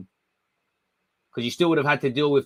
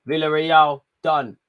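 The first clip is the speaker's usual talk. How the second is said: usual speaking voice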